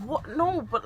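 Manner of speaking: normal talking voice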